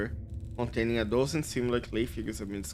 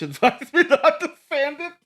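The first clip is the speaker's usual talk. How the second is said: laughing; 9 dB louder than usual. Real, not acted. Laughing at her own joke.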